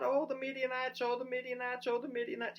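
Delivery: high whiny mock lament; mock whining. Preacher mocking Gideon's crying with a high whine. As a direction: mimic a whiner. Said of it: "all this whining, all this crying".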